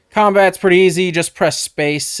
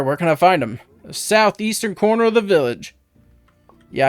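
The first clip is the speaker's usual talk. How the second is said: ordinary delivery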